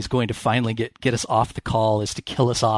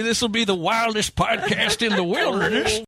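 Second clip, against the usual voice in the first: put-on high, squeaky old prospector; cackling old-timer, way up high; 10 semitones higher than usual. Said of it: "Old man".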